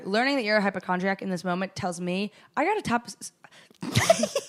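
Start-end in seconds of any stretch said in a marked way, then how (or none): 2.6-4.4 stumbling and catching on the s; tongue-tied, stuck on a hissed s; real stutter. Real, not acted. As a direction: trip over the word and get stuck. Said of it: "she has a terrible stutter".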